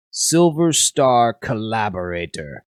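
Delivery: loud, slow accusing yell. Acted. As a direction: yell the accusation out like a guard captain. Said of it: "he yells out".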